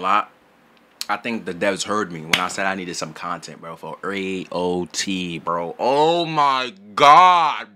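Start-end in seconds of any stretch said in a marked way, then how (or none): 5.8-7.7 excited. Real, not acted. Excited about new content.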